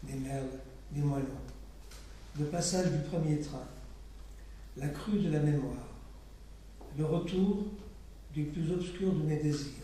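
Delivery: measured, even poem reading with pauses; poetry reading. Acted. Poet reading her own poem to a live audience, measured recital. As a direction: let each image settle before the next.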